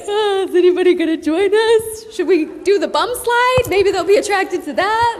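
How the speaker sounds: high, loud playful wondering; hammed-up hopeful call for company; high silly voice wondering if anyone will join; hopeful goofy dancers. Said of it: "We were like".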